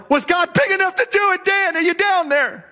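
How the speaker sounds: king calling down to Daniel in the den; anxious calling. Acted. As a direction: shout down into the dark, hoping.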